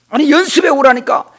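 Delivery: loud, high scolding; scolding teacher. Retold or imitated. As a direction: snap at the student for skipping practice. Professor scolds for not practising.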